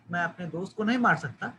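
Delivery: ordinary delivery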